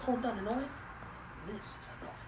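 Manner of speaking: half-whispered quote to herself; quietly recalling the line; whispering to herself. Real, not acted. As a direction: murmur it under the breath, trailing to a whisper. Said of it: "I'm just whispering to myself".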